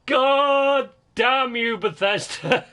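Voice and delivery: high, loud, drawn-out curse; slow, high-pitched outburst of frustration; exasperated yell. Real, not acted. Rages at the game developer.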